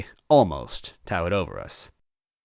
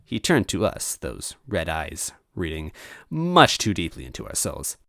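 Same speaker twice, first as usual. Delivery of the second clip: ordinary delivery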